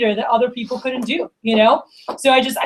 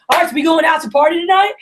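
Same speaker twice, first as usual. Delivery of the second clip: raised, bright teasing question; a cheeky invitation to the birthday boy; playful tease; 6 semitones higher, 3 dB louder, faster than usual. Retold or imitated. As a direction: egg him on to go party. Teasing brother on his birthday.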